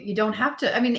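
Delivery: normal talking voice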